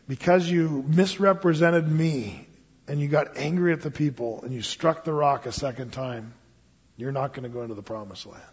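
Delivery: the Lord telling Moses his sentence, hushed and grave; solemn judgement. Retold or imitated. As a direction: deliver the sentence gravely and quietly.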